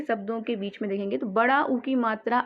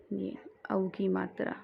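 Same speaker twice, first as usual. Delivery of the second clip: breathy whisper, much softer; 3 semitones lower, 9 dB quieter than usual. Shown as a demonstration. Teacher whispering the vowel sign to students. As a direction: whisper it like a secret reminder.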